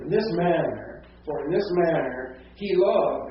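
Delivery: MANNER and LOVED pushed high and loud; weird front-loaded emphasis. Shown as a demonstration. Teacher demonstrates odd Greek-order reading, heavy stress on "this manner". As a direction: punch "this manner" and "loved" oddly hard. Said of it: "would really be weird to read like that".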